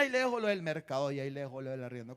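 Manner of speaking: rápido y más grave tras el grito; retahíla de cobro. Acted. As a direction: suelta los cobros de corrido. (retahíla rápida de cobrador)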